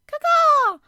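high, loud cawing like a crow; shrill bird call, loud and abrupt. A narrator's reading. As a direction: caw it out sharply like a big crow. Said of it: "quite loudly".

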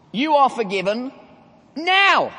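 "are" and "now" punched loud and high; stress on are and now. Shown as a demonstration. Preacher stresses that forgiveness happens now. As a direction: press on the present tense, are forgiven now. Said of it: "The forgiveness is happening as I speak".

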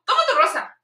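high-pitched mock rejection; retold annoyed refusal. Tourist gives the seller his rose back, high annoyed retort.

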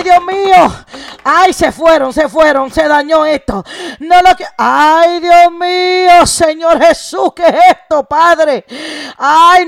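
impassioned preaching with stretched cries of lament; fervent preaching. Real, not acted. Preacher voices those unwilling to share with Penina, fervently. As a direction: preach it hard, wailing the exclamations long.

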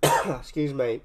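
choking cough then excuse me; coughing up water. Real, not acted. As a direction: clear throat after water went wrong. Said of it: "that water went down the wrong way".

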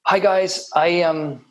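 a light put-on Irish lilt, greeting the guys; Irish accent. A teacher greeting the class as a jumper, in a mock Irish accent. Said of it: "put in my Irish accent".

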